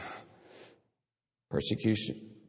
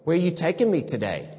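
put-on little girl asking; 6 semitones higher than usual. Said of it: "Harper says".

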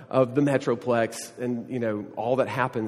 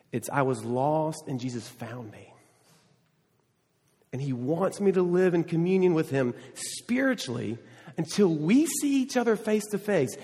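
ordinary talk

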